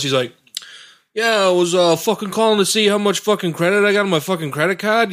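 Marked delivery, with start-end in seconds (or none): 1.1-5.1 East Coast caller. Retold or imitated. Phone rep imitating a swearing East Coast customer. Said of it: "This dude from the East Coast".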